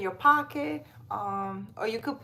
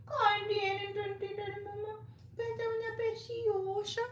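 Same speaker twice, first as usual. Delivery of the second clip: high, softer sing-song pet talk; 11 semitones higher, 7 dB quieter than usual. Real, not acted. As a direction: coo lovingly at the cat. Talking baby talk to her cat.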